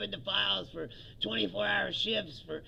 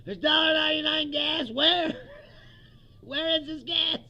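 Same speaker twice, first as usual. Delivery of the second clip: higher, louder shouts; mock shouting; 5 semitones higher, 6 dB louder than usual. Comic shouting Trump's cheap-gas claim back at him. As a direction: yells the question in disbelief.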